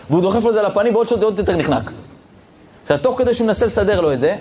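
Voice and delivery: ordinary talk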